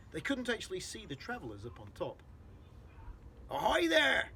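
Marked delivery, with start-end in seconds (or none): 3.5-4.3 a loud, high call upward; big, ringing "Ahoy there!"; shouted hail. Acted. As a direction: hail the roof like a police chief. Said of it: "shouted the chief of police".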